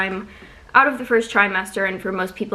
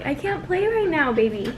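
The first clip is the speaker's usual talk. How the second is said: high sing-song pet voice; talking to a pet; 7 semitones higher than usual. Real, not acted. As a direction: coo at the dog to wait. Telling dog to stop playing.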